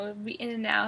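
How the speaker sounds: ordinary delivery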